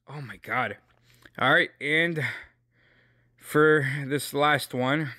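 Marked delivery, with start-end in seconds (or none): none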